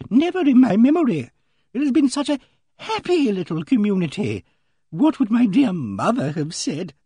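high, agitated lady's voice, swelling and subsiding; Emily Barton, agitated. A narrator's reading. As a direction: fret aloud in distress, pitched high. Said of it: "went on in an agitated manner".

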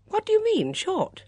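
startled question. A narrator's reading. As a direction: demand an explanation in surprise. Bunch startled by aunt's remark.

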